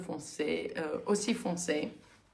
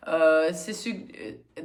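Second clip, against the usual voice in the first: usual speaking voice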